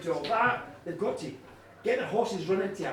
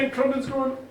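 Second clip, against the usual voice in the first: ordinary delivery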